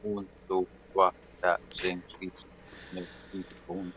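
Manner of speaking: very soft, low counting under the breath; muttered counting. Real, not acted. Player counting in French while waiting, muttered. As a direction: count off privately, fading almost to nothing.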